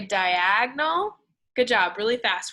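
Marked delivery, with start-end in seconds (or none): 0.0-1.2 "diagonal" drawn out slowly; slow stretched word. Shown as a demonstration. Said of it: "Go the other way".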